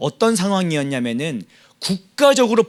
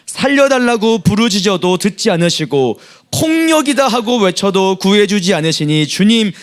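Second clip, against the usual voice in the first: loud, higher group recitation; scripture reading; 4 semitones higher, 4 dB louder than usual. Acted. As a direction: read the lament out boldly. Reads scripture with congregation. (힘 있게 함께 성경 낭독)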